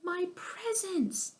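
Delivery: high, slow wail. A narrator's reading. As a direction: drag it out in dismay. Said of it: "cried Irwin".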